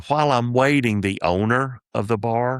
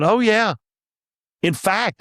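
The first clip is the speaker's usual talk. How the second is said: cocky boast; 12 semitones higher, 3 dB louder than usual. Retold or imitated. Cocky reply to pool guy.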